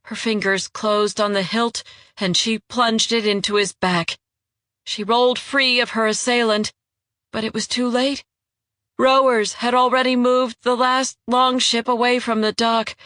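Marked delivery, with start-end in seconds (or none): none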